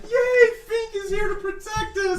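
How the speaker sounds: very high, slow cheer; high squealing townswomen; mock swooning women. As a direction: gush like adoring fans. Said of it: "the women are like".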